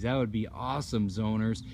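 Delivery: ordinary talk, nothing marked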